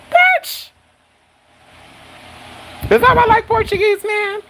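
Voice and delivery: very high falsetto disbelief; mock shock. Reacting to news of Portuguese blood, falsetto mock shock. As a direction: squeal the questions, wildly incredulous.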